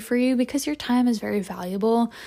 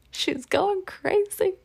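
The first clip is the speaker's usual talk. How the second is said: high, slow squeaky voice; squeaky baby voice; 10 semitones higher, slower than usual. Pretend. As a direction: talk like cooing at a pet. High voice about herself.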